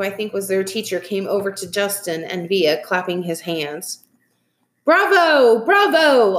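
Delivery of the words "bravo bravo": loud, high acclaim; raised, delighted congratulation; cheering bravo. A narrator's reading. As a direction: call out bravo to the performers. Teacher arrives clapping, cheering performers.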